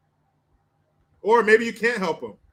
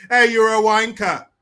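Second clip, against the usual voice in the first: loud, higher hammed-up British insult; mock British accent; 4 semitones higher, 8 dB louder than usual. Mocking British slang, acted insult.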